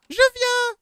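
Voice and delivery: high, loud call. Acted. Calling to the little girl by the car, calling out. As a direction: sing it out high to someone waiting.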